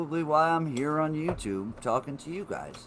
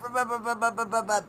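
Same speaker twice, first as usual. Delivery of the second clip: imitated rattling noise; 9 semitones higher than usual. Imitating nickel rattling again.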